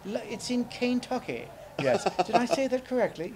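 high, posh British puppet voice; British accent. Pretend.

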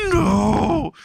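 high, drawn-out girlish mock scream; hammed-up falsetto panic; screaming like little girl. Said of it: "starts screaming like a little girl".

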